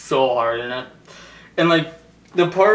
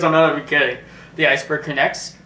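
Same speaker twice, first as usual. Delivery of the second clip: normal talking voice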